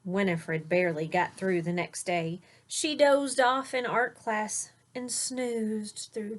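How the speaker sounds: put-on lilting rhyme read to a child; read-aloud storytelling. A narrator's reading. Parent reading a rhyming bedtime book aloud to a child. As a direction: read the verse with a gentle bounce.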